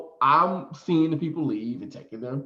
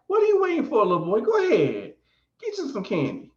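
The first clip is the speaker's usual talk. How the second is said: coaxing man voice; 12 semitones higher than usual. Retold or imitated. Man urging the "little boy.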